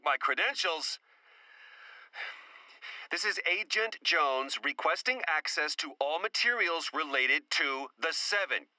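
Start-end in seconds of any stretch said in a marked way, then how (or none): none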